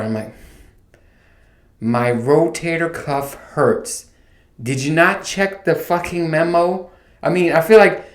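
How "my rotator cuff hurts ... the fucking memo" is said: slow, deliberate mock patience; retold fed-up reply, words dragged out; exasperated. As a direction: spell it out slowly, barely containing irritation. Acts exasperated answer to doctor.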